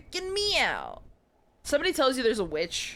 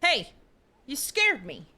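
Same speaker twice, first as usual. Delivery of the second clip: ordinary talk, nothing marked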